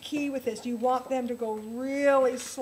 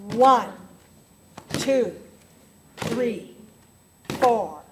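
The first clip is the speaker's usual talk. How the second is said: loud count-in, each number called out; 8 dB louder, slower than usual. Shown as a demonstration. Teacher counts the class in with a loud one, two, three, four. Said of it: "we're going to count".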